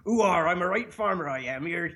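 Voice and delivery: broad Cornish farmer impression, West Country accent. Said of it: "is the West Country accent".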